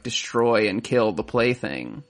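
normal talking voice

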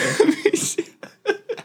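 high, wheezy laughing fit; real squeaky giggling fit; helpless laughter. Real, not acted. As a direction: crack up uncontrollably. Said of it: "you're making me cry".

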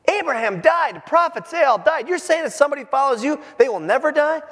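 mock his claims with outrage; indignant crowd.

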